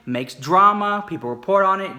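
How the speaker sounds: ordinary delivery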